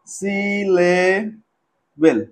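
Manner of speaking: "Syllable" drawn out very slowly, piece by piece; broken into syllables. Shown as a demonstration. Teacher showing students what a syllable is, "syllable" slowly broken up. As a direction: stretch "syllable" with gaps between syllables. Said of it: "ছোট ছোট টুকরো করে ভাঙবো".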